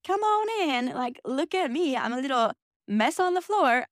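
mock cheerful invite. To friends at the closet door, mock chirpy welcome. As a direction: sing it out like a fake bright host. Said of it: "I was not in the headspace to be like".

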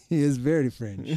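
put-on botched, vaguely French accent; mock French accent. Joking about a new French coach, muddled French accent. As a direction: laugh into an unplaceable attempt at a French accent. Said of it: "I don't even know what that accent was".

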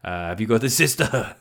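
lightly voiced weirdo greeting strangers. Someone walking up to strangers, talking like a weirdo. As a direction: play an oddly over-familiar questioner. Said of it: "you just sound like a fucking weirdo".

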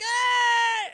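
sudden high, loud rock scream; [name] yell. Retold or imitated. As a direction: belt out a short wild "Ah!". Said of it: "Sammy's got a guitar and Sammy's like".

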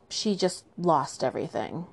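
ordinary delivery

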